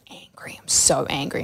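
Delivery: very quiet, half-whispered admission; muted retold anger. Client answering her therapist about feelings, muted anger. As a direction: imitate admitting anger under your breath.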